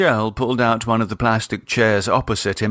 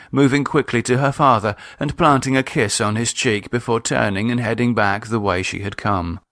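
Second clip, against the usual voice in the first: ordinary delivery, nothing marked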